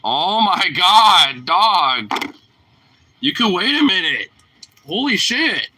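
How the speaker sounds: slow, loud, fed-up groan at the dog; exasperated. Real, not acted. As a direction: scold the pushy dog, half laughing. Dog jumping at the food.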